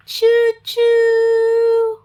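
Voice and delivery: high, far-off train call after a gasp; excited choo-choo. Acted. Showing the child the train, a high choo-choo. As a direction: toot it like a toy train.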